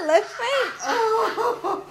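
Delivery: words through high laughter; laughing. Real, not acted. Laughing while making the bet.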